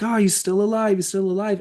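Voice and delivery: high, frantic relief; excited. Retold or imitated. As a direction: cry it out high and eager. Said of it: "they're like".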